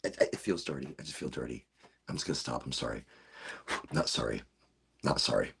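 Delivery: low, soft mock-guilty murmur. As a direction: mutter a mock apology.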